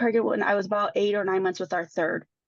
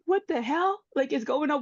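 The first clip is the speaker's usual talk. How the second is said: higher-pitched exasperated disbelief; retold outrage; 5 semitones higher than usual. Mother reacting to marks on children's necks, outraged disbelief. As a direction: recount it with appalled disbelief.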